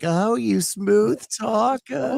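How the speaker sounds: high, slow New York accent impression; Marisa Tomei accent. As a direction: stretch each word in a mock New York accent. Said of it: "talk in that a-, in that accent".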